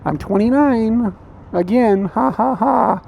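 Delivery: high, slow mock coy laugh; coy age joke. Narrator's birthday, mock coy age joke. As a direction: fake a coy titter after the line. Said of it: "what do women always do".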